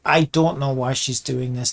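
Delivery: ordinary talk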